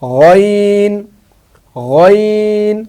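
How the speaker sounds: teacher sounding out গইন; letter name demo. Shown as a demonstration. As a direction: draw out গইন very slowly from the throat. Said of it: "পিছনে দিলাম".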